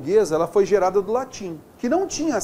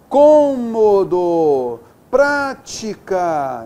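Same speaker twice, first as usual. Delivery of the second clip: Cômodo and prática stretched out; slow example words; 4 dB louder, much slower than usual. Shown as a demonstration. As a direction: drag each word to show the stressed syllable. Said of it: "Está vendo só?". (devagar, marcando a tônica)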